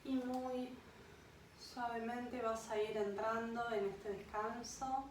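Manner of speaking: unhurried meditation voice with long pauses; guided meditation. Acted. Yoga guide leads a listener to an imagined beach, guided meditation. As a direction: guide them gently into rest, phrase by phrase.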